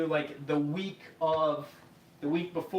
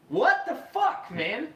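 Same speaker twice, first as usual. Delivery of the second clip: much higher, louder, slowed outburst; exasperated outburst; 8 semitones higher, 4 dB louder, slower than usual. Retold or imitated. Tenant to his roommate after the final notice, exasperated outburst. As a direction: let the frustration explode. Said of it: "And I'm like".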